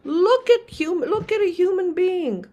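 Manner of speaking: loud, high, urgent call; insist, almost shouting, that they look; emphatic exclamation. Retold or imitated. Voicing Mark's plea to see a human being, loud and high. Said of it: "He said".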